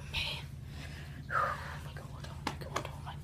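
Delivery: pained repeated whisper. Real, not acted. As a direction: gasp it out softly. Enduring ice.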